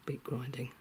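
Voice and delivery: whispered correction; softly fix the word; quiet correction. Real, not acted.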